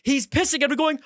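ordinary talk, nothing marked

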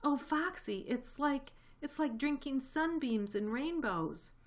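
high-pitched rapture, halting for words; enraptured. A narrator's reading. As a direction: gush in wonder over the drink. Badger enraptured by the cider.

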